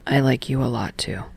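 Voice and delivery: quiet, low, almost shy suggestion; low tender Tsukishima. Acted.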